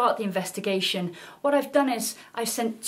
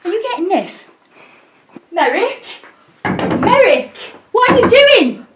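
shrieking higher and louder, building; shocked shout; 12 semitones higher, 12 dB louder than usual. Acted. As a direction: scream in total disbelief. Sees colleague doing something shocking.

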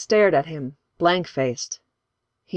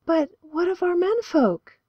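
put-on high, timid girl's voice asking; meek question. A narrator's reading. A girl asks the pastor about the men, meek and timid. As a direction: ask shyly, barely daring. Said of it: "Lucille asked meekly".

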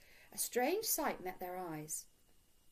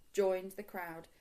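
usual speaking voice

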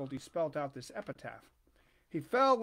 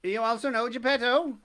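pigeon's voice; 4 semitones higher, 7 dB louder than usual. A narrator's reading. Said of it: "he asked".

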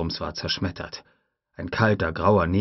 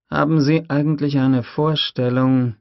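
lightly raised, weary questioning voice; a voiced, doubting Malcolm; 7 semitones higher, 6 dB louder than usual. Malcolm asks Hammond, in a lightly voiced doubting tone. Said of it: "fragte er".

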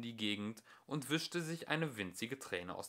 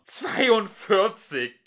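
high, slow, much louder shriek; 11 semitones higher, 14 dB louder, much slower than usual. Acted. Lunkual shrieks at the computer in disbelief. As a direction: shriek it in outrage. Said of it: "kreischte Lunkuai los".

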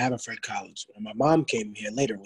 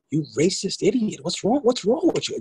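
retold outburst; 6 semitones higher than usual. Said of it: "my mom lost it".

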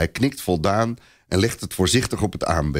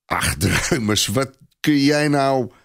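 slow, scornful put-down, lightly voiced; a soldier scoffing at a little boy; mocking laugh; 5 semitones higher, slower than usual. A narrator's reading. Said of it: "lacht Clopin".

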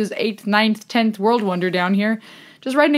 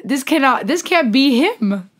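usual speaking voice

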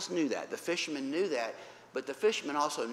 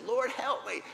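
high, louder plea; urgent cry for help; 10 semitones higher, 5 dB louder than usual. Acted. Peter sinking, calling Jesus for help. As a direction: call out sharply to be rescued.

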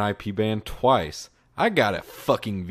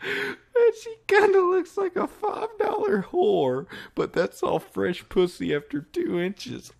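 laughing. Real, not acted. Rereads line laughing.

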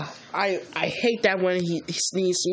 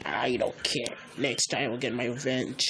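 lower, softer sulky villain; 7 semitones lower, 4 dB quieter than usual. Pretend. Green monster vowing revenge on the heroes, low. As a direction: grumble a threat like a beaten baddie.

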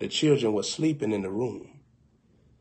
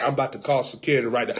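ordinary delivery

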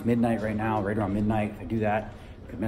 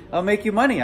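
put-on Vietnamese accent; 8 semitones higher, 4 dB louder than usual. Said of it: "impersonating a guy who has Vietnamese accent".

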